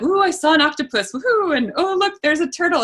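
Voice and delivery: high-pitched playful woohoo of wonder; mock excitement. Diver retelling her octopus and turtle sightings, mock-excited. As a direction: exclaim over sea creatures, hammed up.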